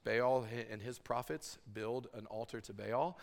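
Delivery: usual speaking voice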